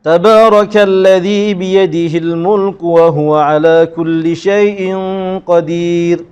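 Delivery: "qadir" held for two beats, slow recitation; two-beat vowel. Shown as a demonstration. Teacher shows the two-harakat length. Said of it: "Dua harakat".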